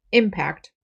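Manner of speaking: "impact" stressed on IM; stress on first syllable. Shown as a demonstration. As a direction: say "impact" as IM-pact. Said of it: "stress is on the first syllable".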